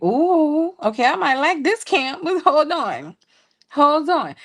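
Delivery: high, intrigued and pleased; excited ooh. Retold or imitated. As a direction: sound tempted but wary. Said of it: "I was like".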